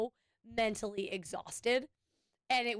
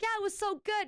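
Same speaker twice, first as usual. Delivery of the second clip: imitated enthusiasm; 10 semitones higher than usual. Retells coworker's enthusiastic compliment.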